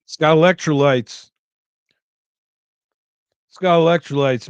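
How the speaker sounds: hammed-up quote from a dumb commercial; Idiocracy movie quote. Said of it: "Idiocracy, great movie".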